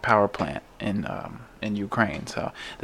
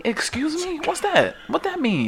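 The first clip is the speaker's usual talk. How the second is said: high squeaky mock outrage; mock confused squeal. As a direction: play dumb in a shrill high squeak. Mock outrage at science talk.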